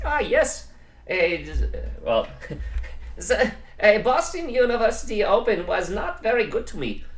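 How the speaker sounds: high mock German accent with a laugh breaking in. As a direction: imitate the German opponent in a put-on German accent. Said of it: "this is gonna be my German accent".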